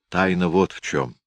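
plain reading voice

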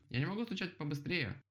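high protesting reply; calling back. A narrator's reading.

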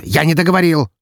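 loud, high barked rebuke; harsh barking commands. Acted. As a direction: bark it at her. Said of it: "рявкнул он".

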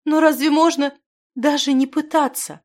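high, loud tearful protest; distraught, pleading girl's voice. A narrator's reading. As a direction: protest as if in tears. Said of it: "заливалась слезами девушка".

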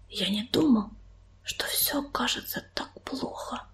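hushed, dismayed whisper. A narrator's reading. Young warrior learning how bad things are at home, whispering. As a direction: barely voice it, very soft. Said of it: "прошептал он".

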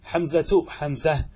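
حمزة as hamzat then hamzah; t then h contrast. Shown as a demonstration. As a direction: contrast the two endings of حمزة. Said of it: "تقلب التاء إلى هاء". (حمزة بالتاء ثم بالهاء)

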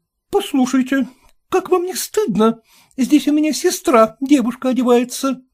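put-on high, shocked young woman; lady's voice, scolding; reproachful lady. A narrator's reading. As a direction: shame the landlord for barging in.

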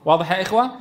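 ordinary talk, nothing marked

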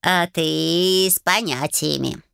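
slow purring cat voice. Acted. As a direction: drawl it like a smug old cat.

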